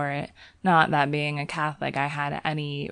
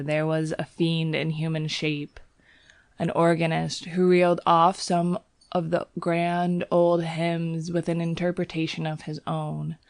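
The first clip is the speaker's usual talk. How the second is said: usual speaking voice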